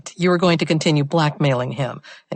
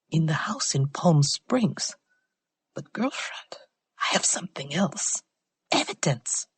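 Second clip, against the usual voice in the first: whisper; 7 dB quieter than usual. Acted. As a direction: hush it so nobody overhears. Informant whispering secrets by phone.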